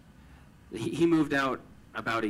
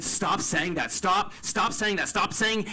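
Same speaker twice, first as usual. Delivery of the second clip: much higher and louder, desperate demand; frantic raised plea; pleading shout; 10 semitones higher, 6 dB louder than usual. Retold or imitated. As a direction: cry out for him to stop, dragging out the last anything. Begging brother to stop apologizing.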